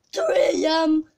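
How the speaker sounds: startled yelp at the time; loud shocked cry; shocked shout. Acted. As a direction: blurt it out in disbelief. Shocked at the clock.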